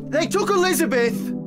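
high, urgent alarm; alarmed cry. Acted. Frantic report of a kidnapping.

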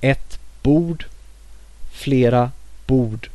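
careful Swedish: bord, hus, målare, unchanged in plural; teacher modelling unchanged Swedish plurals; Swedish demo words. Shown as a demonstration. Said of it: "no inflection".

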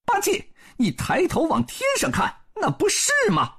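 very high-pitched pointing out; Monkey King voice. A narrator's reading. Sun Wukong tells Bajie to look up, high Monkey voice. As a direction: pitch it high, directing his gaze.

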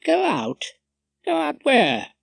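high-pitched baffled questions; puzzled. A narrator's reading. Rumpole not following his colleague's remark, puzzled. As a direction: query it in bafflement, pitch jumping up. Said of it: "I was puzzled".

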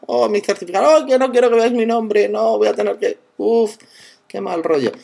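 high, alarmed mock panic over his name showing. Real, not acted. Seeing his name on screen, high mock panicked alarm. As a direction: yelp like your secret just got exposed.

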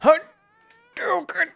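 high, slow, drawn-out battle cry; fighting game move shout. Pretend. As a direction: call out the special attack.

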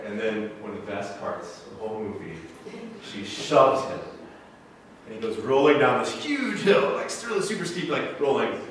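usual speaking voice

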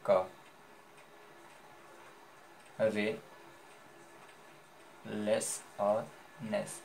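का, रे, लेस और नेस with long gaps, slow and soft; syllable by syllable. Shown as a demonstration. Teacher asking what each part becomes, का, रे, लेस, नेस spaced slowly. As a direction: dictate का, रे, लेस और नेस piece by piece. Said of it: "तो क्या हो गया".

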